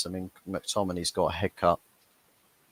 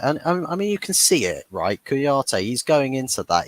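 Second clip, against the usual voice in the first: excited; 6 semitones higher, 4 dB louder than usual. Real, not acted. Excited point about Kouyaté.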